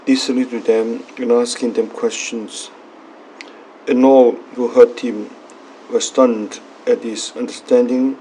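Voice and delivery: steady, solemn proclamation of scripture; Gospel reading. Acted. Priest reading the Gospel at Mass, solemn and measured. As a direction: read the Gospel aloud to the congregation.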